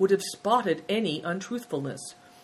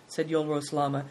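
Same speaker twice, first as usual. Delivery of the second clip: usual speaking voice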